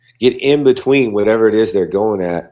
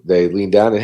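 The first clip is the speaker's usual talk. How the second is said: ordinary delivery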